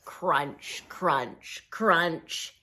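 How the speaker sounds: low, soft crunching sound words. Acted. Reading to kids, low soft crunching steps. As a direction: step slowly through each crunch.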